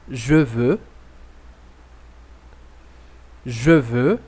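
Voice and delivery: each phrase said twice, clear and deliberate with a rising end; slow repeat-after-me drill. Shown as a demonstration. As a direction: model the sentence slowly so the class can echo it.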